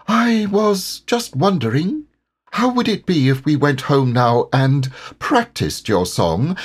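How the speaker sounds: high, small and timid Piglet voice. Acted. Piglet timidly suggesting they go home, high and small. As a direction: ask shyly, not wanting to give in. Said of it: "a little timidly".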